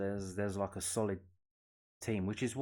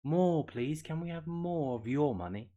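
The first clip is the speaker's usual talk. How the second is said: higher, louder begging orphan voice; a hammed-up workhouse orphan asking for more; mock Oliver Twist plea; 6 semitones higher, 4 dB louder than usual. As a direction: hold out the bowl and beg like Oliver Twist. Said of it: "like Oliver Twist".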